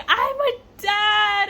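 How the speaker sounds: high, loud and drawn out slowly; overjoyed exclaim. Retold or imitated. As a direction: stretch out the joy of becoming a father. Husband sees baby pictures.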